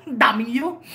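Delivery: ordinary talk, nothing marked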